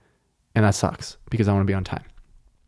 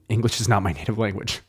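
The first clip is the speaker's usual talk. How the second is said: ordinary talk, nothing marked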